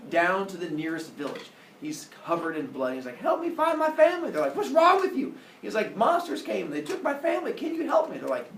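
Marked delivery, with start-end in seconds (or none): 3.2-4.3 high, loud cry for help; mock desperate plea. Bloodied hero begs villagers for help. 4.5-5.3 high, loud appalled villagers; mock alarm. Villagers alarmed by bloodied hero. 6.0-8.2 lightly acted high-pitched panic; mock frantic plea. Hero pleads with villagers.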